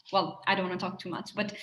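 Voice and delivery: ordinary delivery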